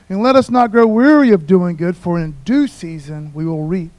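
loud, declaimed verse; forceful pulpit reading that eases toward the end; scripture reading. Acted. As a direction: proclaim the verse to the congregation. Reads scripture aloud at force.

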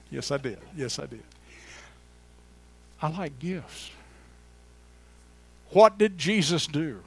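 ordinary talk, nothing marked

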